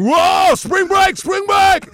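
high, very loud party whoop; pumped-up frat boys. Retold or imitated. Frat-boy wrestlers on camera, mock pumped-up shouting. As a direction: imitate hyped-up wrestlers yelling. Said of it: "all pumped up going".

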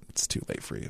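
hushed ominous whisper. Real, not acted. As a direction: breathe it like a warning. Whispered punchline.

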